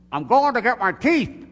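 high, loud comic outburst; exasperated mock shout. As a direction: belt out the punchline as the fed-up husband. Husband's exasperated punchline in joke.

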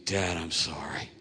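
soft, slow contrition; imitated humble apology. Son sitting next to dad after storming off, soft apology. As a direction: say sorry low and slowly.

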